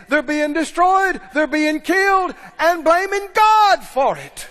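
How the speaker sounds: preaching hard. Acted. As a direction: hammer it home from the pulpit. Preaching at full force.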